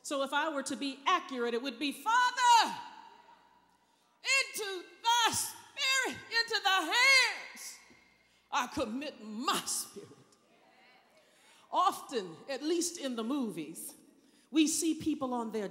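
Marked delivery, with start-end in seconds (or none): 1.9-10.1 cried out loud. Shown as a demonstration. Preacher acting Jesus crying out from the cross, loud. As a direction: cry it out to heaven. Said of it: "cried out with a loud voice".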